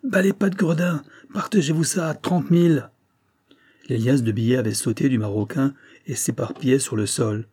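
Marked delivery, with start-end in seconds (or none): 0.0-2.9 scornful shout at the railwaymen; defiant shout. A narrator's reading. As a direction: hurl it at the thugs. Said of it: "en proférant".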